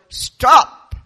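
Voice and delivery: huge booming shout; giant's yell. Acted. Giant father yelling at the cars in the road. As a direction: bellow it to stop the traffic. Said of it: "looked at the cars and yelled".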